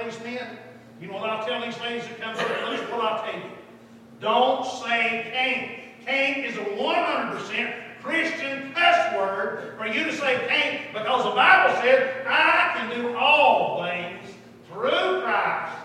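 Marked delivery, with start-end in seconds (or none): 4.2-15.8 loud, high revival exhortation; full-force preaching. Real, not acted. Preacher to congregation against excuses, forceful. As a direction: hammer it home, stretching the key words.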